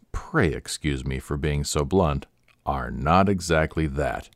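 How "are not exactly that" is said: usual speaking voice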